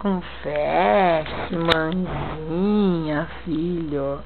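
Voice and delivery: soft, low, slow coaxing. Real, not acted. Mother coaxing baby softly.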